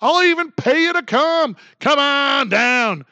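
high, drawn-out come on down; Santa Claus game-show call. Retold or imitated. Santa Claus luring migrants, high pitched hammed-up game-show call. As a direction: beckon like a game show host, big and high. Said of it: "when Santa Claus says".

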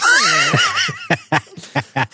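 put-on high quavering old man laugh; wavery old man laugh. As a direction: cackle like a frail old man. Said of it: "cue me up a little bit of that old man voice".

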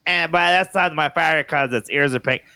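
mimicking a child's show-and-tell; little daughter's presentation. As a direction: present it like a little kid, drawing out the picks. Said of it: "she's got a good presentation".

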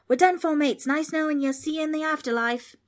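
high, loud Australian farewell; Australian accent. A narrator's reading. Boy bids mates goodbye, despairing Australian accent. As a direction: cry doom in an Australian accent.

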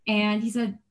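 usual speaking voice

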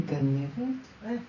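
low, slow, laughing gasp of shock; shocked at herself. Retold or imitated. The speaker catches herself wanting to keep a phone, shocked and laughing. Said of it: "אמרתי לעצמי".

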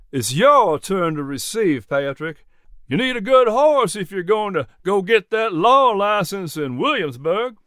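lightly voiced genial old farmer; a hint of a friendly older neighbour. Narrator voices Jack Poindexter.

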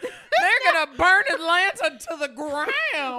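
loud, slow melodramatic Southern belle; mock Southern belle. Teasing the reader with a hammed-up Southern belle line. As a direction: declare it with slow Southern doom.

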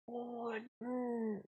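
breathy, barely voiced; baffled under your breath; stunned murmur. Host reacts to the vanished house, stunned and very quiet. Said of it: "I'm just like".

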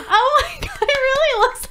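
high, laughing, near tears; delighted gasp. Real, not acted. Unwrapping her own portrait.

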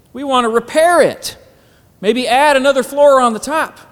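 high, loud mock persuasion. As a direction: pitch it up to mock the excuse. Mocking the fleshly excuse.

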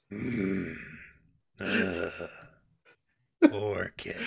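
low, slow groaning zombie bear. Acted. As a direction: moan it out like an undead bear. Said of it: "I sound like a zombie when I do that".

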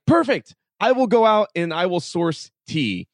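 high, loud burst of excitement; excited decision. Retold or imitated. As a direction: announce it like a eureka moment. Thrilled at the tea idea.

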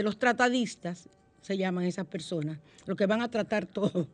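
normal talking voice

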